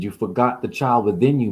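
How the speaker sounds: normal talking voice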